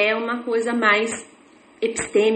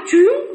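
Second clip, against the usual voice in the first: quick whoosh; 10 semitones higher, 5 dB louder than usual. Retold or imitated. Car starting effortlessly.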